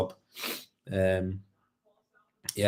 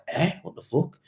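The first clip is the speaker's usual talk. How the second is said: disbelief; 8 semitones higher than usual. Retold or imitated. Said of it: "I'm like".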